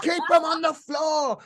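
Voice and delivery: put-on high, shouted Scottish accent; shouting Scottish accent. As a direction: yell it like the Scottish instructor. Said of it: "with a really Scottish accent".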